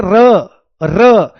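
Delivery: र said with the tongue vibrating; trilled र. Shown as a demonstration. Teacher shows students how the tongue shakes on र, trilled demo. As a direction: roll र so the tongue visibly flutters. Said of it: "जीभ में ना साइडों में ऐसे हिलते हुई दिखाई देगी".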